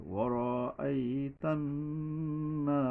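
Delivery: ওয়ারাআইতান্না with the ন্ন hummed long; slow nasal hum; nasal ghunna. Shown as a demonstration. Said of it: "গুণনা করতে হবে".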